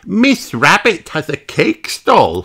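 a bright piglet explaining; Peppa's voice. Acted. Peppa explaining the walk to Granny, high and chirpy. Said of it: "says Peppa".

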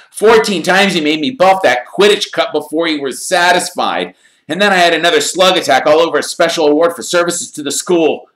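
put-on high, loud boyish complaint; lightly voiced Ron. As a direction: grumble like a worn-out schoolboy.

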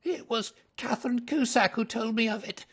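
high, crackling frightened confession; cracked, high-pitched suspect's voice. A narrator's reading. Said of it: "said he in a crackling voice".

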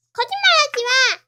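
high loud scolding; loud furious denial; angry accusation. Acted. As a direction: call out the lie. Accusing of lying.

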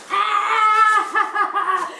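high, loud squeal breaking into laughter; excited scream. Real, not acted. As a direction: let out a real giddy shriek. Overwhelmed before opening the gift.

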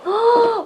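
sharp high gasp of amazement; impressed gasp. Acted. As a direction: gasp as if wowed by the room. Gasps at dress room.

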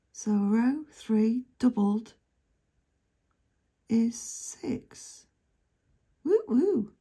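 loud happy cheer; excited. Real, not acted. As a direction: celebrate the win out loud. Winning six pounds.

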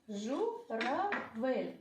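slow, stretched журавель; журавель drawn out for kids; drawn out slowly. Shown as a demonstration. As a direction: lengthen every syllable of журавель. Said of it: "Поділимо на склади".

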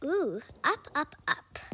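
ordinary talk, nothing marked

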